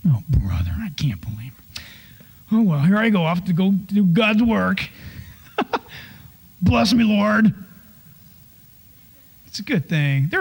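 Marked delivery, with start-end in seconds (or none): 0.0-7.5 half-whispered grumbling, then a mock sigh of duty. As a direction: grumble reluctantly to yourself. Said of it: "And I started complaining".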